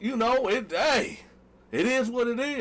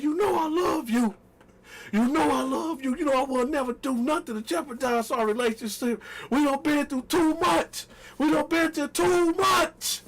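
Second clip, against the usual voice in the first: mock tender plea; 6 semitones higher than usual. On his knees to his girlfriend, a hammed-up tender plea. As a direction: beg her sweetly.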